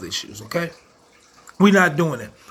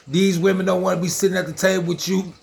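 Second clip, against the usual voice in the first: normal talking voice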